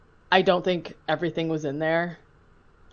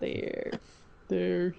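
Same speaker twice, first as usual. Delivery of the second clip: Poltergeist voice; 8 dB quieter than usual. Retold or imitated. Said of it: "[name] doing Poltergeist voice".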